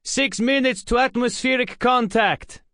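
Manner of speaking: high, loud radio callout; calling out. A narrator's reading. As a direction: announce it sharply to the crew. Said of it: "Anton called out".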